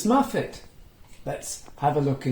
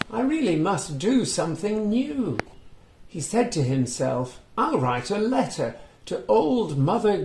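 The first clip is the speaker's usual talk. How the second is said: put-on reading to children; 4 semitones higher than usual. A narrator's reading. Reading a picture book aloud to young children, lively storytelling. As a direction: bring the story alive for little listeners.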